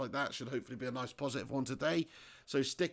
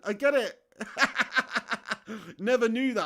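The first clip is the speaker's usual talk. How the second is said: high, loud, through laughter; laughing; 7 semitones higher, 6 dB louder than usual. Real, not acted. Streamer finally gets a viewer's name joke, laughing. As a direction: crack up as the joke lands.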